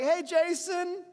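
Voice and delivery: high, slow, welcoming mom voice; friend's mom greeting. Retold or imitated. Friends' mom greets the boy at the door, high and slow. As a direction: greet him like a mom glad to see him.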